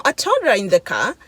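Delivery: normal talking voice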